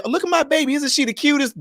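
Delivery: high, doting mock parent voice; gushing parent. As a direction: coo like a proud parent showing off a baby. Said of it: "you come to me and you say".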